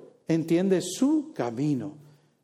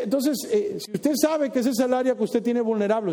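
ordinary talk, nothing marked

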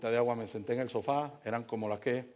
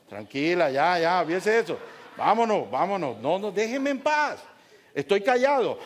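exasperated; 4 semitones higher, 5 dB louder than usual. Retold or imitated. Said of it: "me van regañando las dos".

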